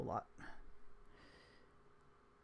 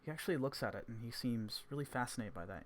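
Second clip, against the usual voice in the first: ordinary talk, nothing marked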